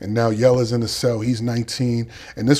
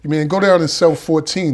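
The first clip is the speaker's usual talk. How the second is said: yelling on the tier; 5 semitones higher, 6 dB louder than usual. Retold or imitated. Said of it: "I'm yelling".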